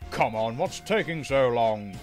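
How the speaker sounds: loud, impatient complaint; grumbling. Acted. Gordon waits stuck with the goods train, grumbling loudly. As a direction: grumble at the hold-up, projecting. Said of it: "grumbling dreadfully".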